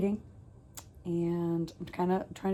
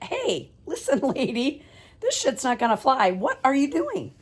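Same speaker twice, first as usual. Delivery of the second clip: higher, louder stern call to mom; mock stern scolding; 7 semitones higher, 3 dB louder than usual. Daughter catching her runaway mom, mock stern.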